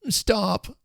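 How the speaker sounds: high urgent call to stop; sharp high stop, stop; secretary calling out to stop the messenger. A narrator's reading. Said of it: "echoed Mister Fish".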